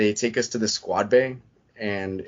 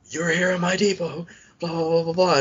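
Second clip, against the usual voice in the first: imitating a drill instructor's frog voice, pitched higher; 9 semitones higher than usual. Drill instructor barking at recruits on the bus, frog voice. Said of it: "with a frog voice".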